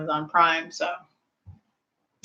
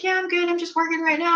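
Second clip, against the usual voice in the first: lightly voiced friend; 11 semitones higher, faster than usual. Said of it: "She's like".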